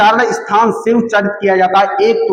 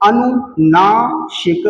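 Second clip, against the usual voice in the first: slow term repeat; much slower than usual. Shown as a demonstration. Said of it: "क्या कहते?".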